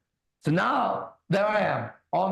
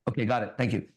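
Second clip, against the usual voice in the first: ordinary delivery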